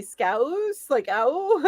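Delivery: Scouse with a drawn-out ow, as a question; trying the pronunciation. Real, not acted. Streamer checks the phonetic spelling with chat, questioning the ow in Scouse. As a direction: test "Scouse" on its ow sound, ending in a laugh. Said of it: "how to spell it phonetically".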